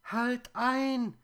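piercingly high, loud spider chorus; loud plaintive squeal, far above natural pitch; high whirring voices. A narrator's reading. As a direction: cry out as tiny complaining creatures. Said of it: "in hohen Tönen sirrende Stimmen".

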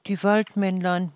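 ordinary delivery

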